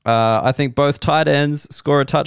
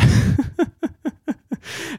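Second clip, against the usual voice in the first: high laugh. Real, not acted. Laughs at own bit.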